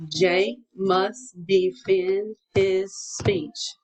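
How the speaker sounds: very slow, separate beats for each word; pounded out slowly. Shown as a demonstration. Teacher leading pupil to pound it, slow separate beats. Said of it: "Pound it".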